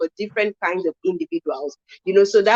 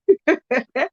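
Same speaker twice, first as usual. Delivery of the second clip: short high laugh; amused laugh; 7 semitones higher than usual. Real, not acted. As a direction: laugh at the absurdity. Laughs at the union's advice.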